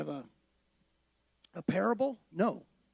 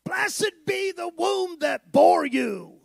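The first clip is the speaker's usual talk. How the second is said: high, loud, drawn-out cry; slow, ringing shout of acclaim; 9 semitones higher, 7 dB louder, slower than usual. Retold or imitated. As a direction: imitate a woman crying out from the crowd. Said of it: "Shouted out in the crowd".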